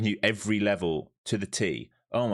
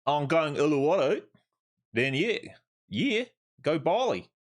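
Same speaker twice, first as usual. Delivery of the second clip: mock bogan Aussie; 7 semitones higher than usual. Mocking Australian tourists headed to Bali, in a hammed-up Aussie accent. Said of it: "fucking bogan Aussies, mate".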